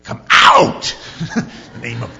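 mock televangelist, drawn out and spitting, exploding on the shout; TV preacher exorcism. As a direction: spit out the words like an over-the-top TV preacher. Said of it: "say it with the right inflection and you spit enough".

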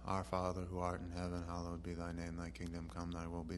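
mock rote Lord's Prayer. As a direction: rattle it off without thinking. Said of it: "something that we can say rote".

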